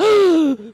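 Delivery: high, hammed-up gasp; retold shocked gasp. Retells his shock at hearing word.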